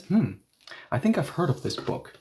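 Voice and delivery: a hint of someone recalling a title; voicing B. B muses aloud on spotting the book's title, lightly voiced. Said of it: "B looks at the title and says".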